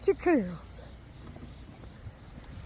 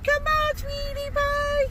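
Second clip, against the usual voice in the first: calling a pet; 8 semitones higher than usual. Real, not acted. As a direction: stretch it out high and coaxing.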